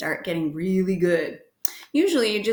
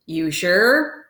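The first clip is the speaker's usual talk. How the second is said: lightly voiced doubtful clerk, louder; cigar-chewing hotel clerk; 2 semitones lower, 5 dB louder than usual. A narrator's reading. Clerk behind the counter doubts visitor, lightly voiced cigar-chewer. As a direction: question it around a cigar, a hint of character.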